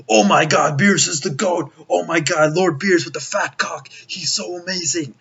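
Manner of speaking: half-hearted fanboy impression; mock fanboy gushing. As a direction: lightly parody an overexcited fan. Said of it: "people were going like".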